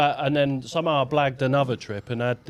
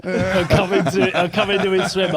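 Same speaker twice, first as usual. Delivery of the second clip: laughing while telling; 5 semitones higher, 6 dB louder than usual. Real, not acted. Laughing retelling of being caught.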